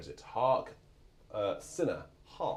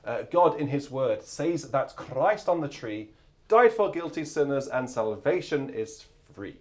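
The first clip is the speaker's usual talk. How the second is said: hymn reading; 4 dB louder than usual. Real, not acted. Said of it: "I did that fairly quickly".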